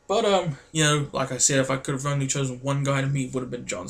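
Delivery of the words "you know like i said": ordinary talk, nothing marked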